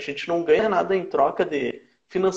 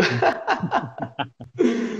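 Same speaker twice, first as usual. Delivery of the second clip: real higher-pitched laugh; 5 semitones higher than usual. Real, not acted. Guest joking to host about stretching his liver, laughing. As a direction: laugh heartily at your own joke.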